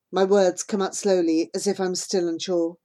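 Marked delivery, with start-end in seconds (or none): none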